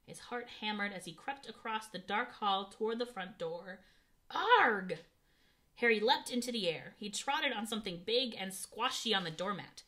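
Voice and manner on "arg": loud startled yelp; a sudden shocked cry; startled yell. A narrator's reading. Stepping on uncle in dark.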